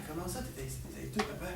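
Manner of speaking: very soft, low, halting question; small, stumbling voice after the rant; hushed doubt. Acted. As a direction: question him under your breath. Son timidly questions ranting father.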